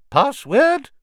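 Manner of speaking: high, loud portrait lady voice; fat lady portrait. A narrator's reading. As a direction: sing out the question like a grand painted lady.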